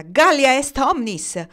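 high, loud, slow declamatory shout; defiant shouted recitation; shouted Latin. A narrator's reading. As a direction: yell it at them, word by word. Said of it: "urlò loro".

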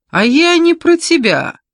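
high, loud taunting cry; loud retort. A narrator's reading. Keith correcting Olga's denial, loud and high.

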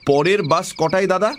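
hesitate, then ask with lifted pitch; polite inquiry. Acted. Asking tea seller about the bus.

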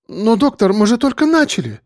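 high-pitched protesting patient; high, aggrieved objection; indignant woman. A narrator's reading. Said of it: "возмутилась женщина".

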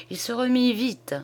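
ordinary delivery

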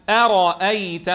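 أرأيت said slowly as one joined word. Shown as a demonstration. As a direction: join أرأيت together slowly. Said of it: "كتدريب عملي".

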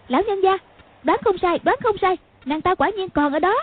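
put-on high, hurried girl's report; breathless excited. A narrator's reading. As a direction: rush the news in. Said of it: "hớt hải chạy tới".

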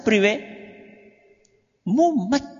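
ordinary delivery

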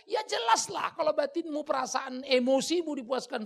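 high, loud preaching cry; preaching shout. Real, not acted. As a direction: drive the point home at full voice.

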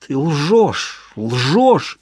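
guttural, through clenched teeth; throaty accusation. A narrator's reading. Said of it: "Её голос звучал гортанно".

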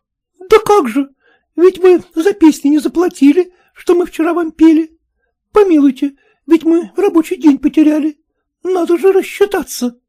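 put-on high pleading village girls asking for their pay; high, insistent peasant girls; peasant girls' voice. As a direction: pitch it high like a chorus of girls wanting their money.

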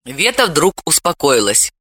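plain reading voice

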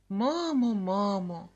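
a mournful call to mum; sad longing. A narrator's reading. Boy remembering happy moments with mum, sad. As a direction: call out wistfully, letting it fall. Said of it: "зі смутком згадував".

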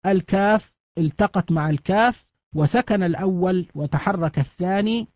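ordinary delivery

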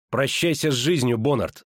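ordinary delivery, nothing marked

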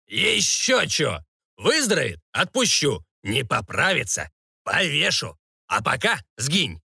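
drawn-out scornful start, then choppy verdicts; dismissive baron. Acted. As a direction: lay down terms like an irritable lord. (барон отрезает, с паузами и презрением)

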